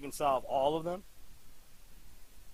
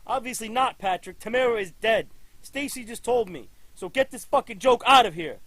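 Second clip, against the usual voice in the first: loud angry barked commands; furious orders; 5 semitones higher, 6 dB louder than usual. Acted. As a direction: throw him out in a rage. Chief orders officer out.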